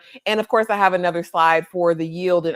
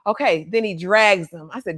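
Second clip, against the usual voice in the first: ordinary delivery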